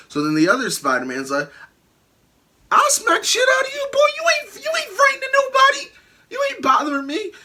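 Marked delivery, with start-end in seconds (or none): 2.7-7.3 very high shouted mocking echo; high mock-tough taunt to Tom; mocking mimicry. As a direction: throw his words back in a squeaky taunt. Other Spider-Man mocks Tom back.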